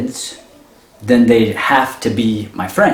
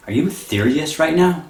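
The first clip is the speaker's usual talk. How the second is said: annoyed; 7 semitones higher than usual. Retold or imitated. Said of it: "he's annoyed".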